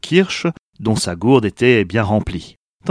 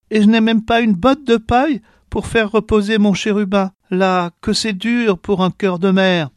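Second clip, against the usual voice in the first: lamenting mother; 9 semitones higher than usual. A narrator's reading. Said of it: "La voyageuse gémit".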